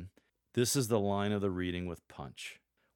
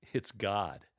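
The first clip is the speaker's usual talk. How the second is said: usual speaking voice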